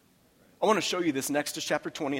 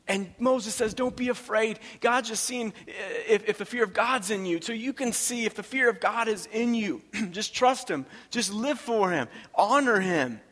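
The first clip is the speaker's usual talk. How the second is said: higher, urgent preacher's delivery; impassioned preaching; 7 semitones higher, 3 dB louder than usual. Acted. Preacher voicing Moses to the trembling people, impassioned. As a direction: preach it high and insistent.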